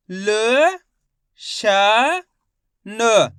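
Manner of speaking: ल, श, न each held alone with long pauses; letter-by-letter sounding out. Shown as a demonstration. Teacher models reading for learners, isolated held syllables. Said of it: "एक एक अक्षर का उच्चारण करके".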